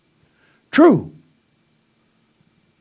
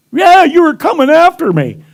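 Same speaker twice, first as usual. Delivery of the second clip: much higher, louder protest; accusing student; 13 semitones higher, 7 dB louder than usual. Retold or imitated. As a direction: complain he was being attacked.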